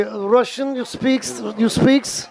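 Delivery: lightly put-on Russian accent; mock Russian accent. Shown as a demonstration. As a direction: demonstrate a fake Russian accent. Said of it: "Do you have a Russian accent at all".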